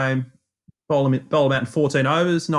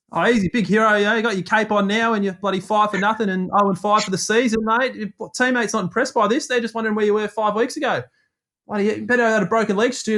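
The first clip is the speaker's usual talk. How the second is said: higher, taunting jeers at the batsman; a cricketer sledging a batsman, higher and jeering; mocking sledge; 7 semitones higher than usual. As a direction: needle him, mock-matey and higher. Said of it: "I think I'll just mercilessly sledge Stu".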